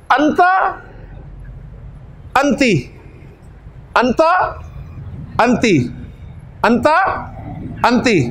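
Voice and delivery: अंता said with an open final a, अंती with a clear final i, alternated; vowel contrast drill. Shown as a demonstration. Teacher drilling students, contrasting अंता and अंती. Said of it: "जेर ज़बर से फ़र्क पड़ गया".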